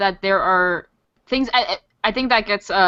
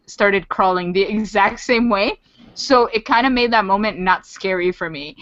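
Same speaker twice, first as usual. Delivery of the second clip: normal talking voice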